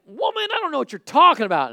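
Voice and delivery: high, loud acted protest; loud, defensive outburst; indignant denial. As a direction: fire back an exasperated denial at an accuser. Acted Peter denying Jesus.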